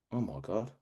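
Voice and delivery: low, hushed realisation; quietly stunned. Retold or imitated. Speaker hit by his mentor's question, hushed and stunned. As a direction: let it sink in very softly.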